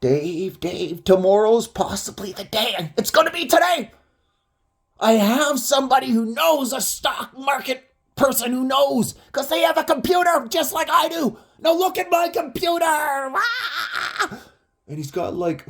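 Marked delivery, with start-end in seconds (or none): 0.0-2.9 higher, insistent nagging imitation. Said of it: "he would just go on like that". 3.0-12.9 frantic, very high raised voice; hammed-up imitation of a man raving with urgency. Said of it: "he would just go on like that". 12.9-14.4 high, drawn-out "aah"; hammed-up crazed wail; mock manic scream. Don raving over his computer, mock high scream. End of mocked frantic rant.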